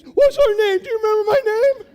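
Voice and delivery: high, loud, fast taunting yell; retold instructor's mocking shout. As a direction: shriek the question down the line.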